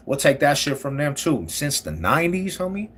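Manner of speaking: ordinary delivery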